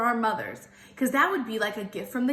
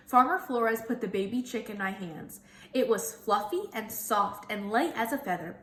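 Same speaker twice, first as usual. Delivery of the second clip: ordinary delivery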